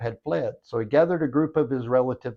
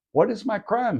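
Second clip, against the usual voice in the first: mildly indignant, high-pitched question; angry demand; 9 semitones higher than usual. A narrator's reading. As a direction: demand an answer with a touch of anger. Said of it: "Then Jacob became very angry".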